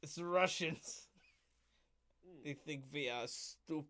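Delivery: put-on a lightly done Russian villain in a broken Russian accent; mock Russian accent. Podcast co-host riffing as a Russian official, lightly done Russian accent. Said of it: "I love doing a Russian accent".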